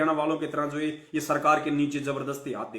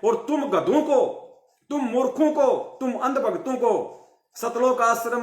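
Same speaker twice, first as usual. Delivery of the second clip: higher-pitched, indignant tirade of insults; angry scolding; 5 semitones higher than usual. Real, not acted. Preacher berating blind followers, angry and raised. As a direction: hurl the insults at the crowd.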